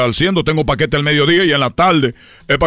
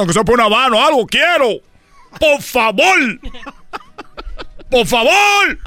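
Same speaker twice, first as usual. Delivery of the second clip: desperate begging; 8 semitones higher than usual. Acted. Son begging his father's image for money, high and pleading. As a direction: stretch out each por favor, begging.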